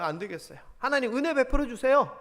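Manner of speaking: ordinary talk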